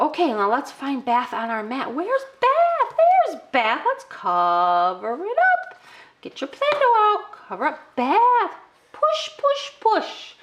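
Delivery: very high, sing-song cheering; child-directed excitement. Shown as a demonstration. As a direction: coach a toddler through the game. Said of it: "I'm talking to you just like I would talk to them".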